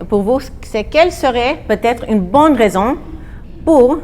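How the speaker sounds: ordinary talk, nothing marked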